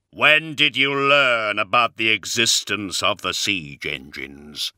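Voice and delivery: booming, heavy warrior voice. Acted. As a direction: speak like a towering giant. Primarch asks Johnson.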